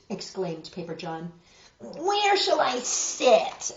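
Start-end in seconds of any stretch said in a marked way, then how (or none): 1.8-3.7 higher, slow devil's demand. A narrator's reading. Devil demanding a seat from Paper John. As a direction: stretch it out like a fussy little devil. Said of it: "the devil demanded".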